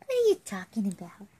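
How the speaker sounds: put-on fast playful whisper to the baby; teasing hushed question. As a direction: breathe it quick and secret.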